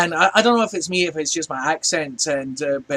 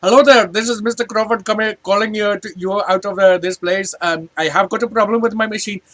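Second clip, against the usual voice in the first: louder, higher mock Indian accent; put-on Indian accent; 5 semitones higher, 6 dB louder than usual. Shown as a demonstration. Scottish customer calling support so they understand him, Indian accent. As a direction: pronounce it carefully in an imitated Indian accent. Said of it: "when I go".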